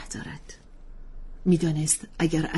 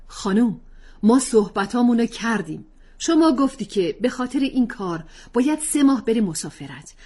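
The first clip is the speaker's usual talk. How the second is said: higher, louder stern husband; father laying down law; 7 semitones higher, 7 dB louder than usual. Acted. Husband shutting down wife's plan, stern and firm. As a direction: end the argument firmly.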